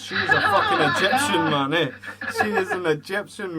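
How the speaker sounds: laughing rant. Real, not acted. Laughing rant about the Queen.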